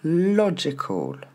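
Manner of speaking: LOGICAL as "LO-gé-col", high start; slow "logical", E-like middle vowel; no I sound. Shown as a demonstration. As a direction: punch LO, fall away to "col". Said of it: "Pas de I, toujours pas".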